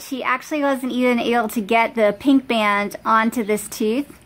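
talking with finger on lip; finger pulling lip down. Real, not acted. Seen 3: Finger pulls lower lip down to show braces through 4.8s.

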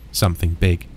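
ordinary delivery

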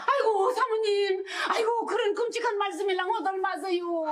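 high, tearful begging; wailing plea. Acted. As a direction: beg the lady with a wail. Mother begging prosecutor's wife. (검사 부인에게 울며 비는 엄마)

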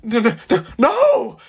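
rising to a high panicked no; disturbed stammering. Acted. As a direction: panic climbing on each no. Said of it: "the man stammered, clearly disturbed".

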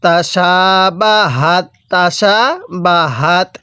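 তাশাবাহাত slow, long "shaa", light h; measured tajweed model of তাশাবাহাত; light ha in recitation. Shown as a demonstration. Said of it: "মুখ দিয়ে হালকা আওয়াজ করতে হবে".

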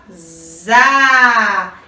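ZA with a long, held A; teacher sounding out a long "za"; syllable sound demo. Shown as a demonstration. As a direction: buzz the Z, then open into a drawn-out "za". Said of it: "A primeira sílaba".

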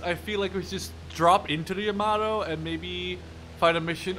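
ordinary delivery

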